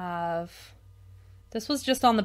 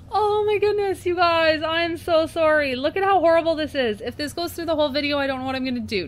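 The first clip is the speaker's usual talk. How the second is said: high, loud, embarrassed apology; flustered laughing apology; 8 semitones higher, 7 dB louder than usual. Real, not acted. Vlogger to viewers about the blurry video, flustered loud apology. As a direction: apologize while still half laughing.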